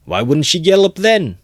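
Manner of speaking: high, loud furious shout; angry retort. A narrator's reading. Mikolka answering the accusation, furious shout. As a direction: snap back at the crowd.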